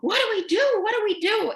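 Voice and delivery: high, frightened, pleading repeat; panicked crying nurse. Retold or imitated. Crying staff nurse asking what to do, high panicked plea. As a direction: beg for answers in panic. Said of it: "she's crying and she says".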